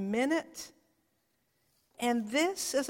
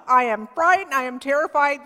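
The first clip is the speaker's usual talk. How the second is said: frightened; 3 semitones higher, 7 dB louder, faster than usual. Retold or imitated. Frightened Zechariah before angel.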